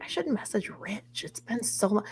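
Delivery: hushed inner thought; whispering. Retold or imitated. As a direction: whisper it to yourself. Retold private thought.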